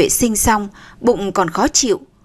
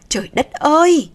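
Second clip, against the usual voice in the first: higher, dismayed exclamation; alarmed exclamation; 5 semitones higher than usual. A narrator's reading. Aunt realising labour has started, alarmed.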